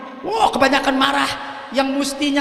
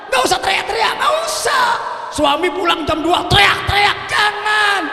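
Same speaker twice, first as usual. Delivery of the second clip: high fervent preaching; 7 semitones higher than usual. Real, not acted. Preacher warning wives not to yell at husbands.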